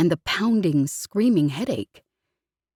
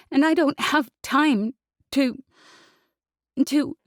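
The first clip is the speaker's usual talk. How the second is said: tearful building rant; rising to tears; 4 semitones higher than usual. Acted. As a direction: build until she breaks. Jez building to breakdown.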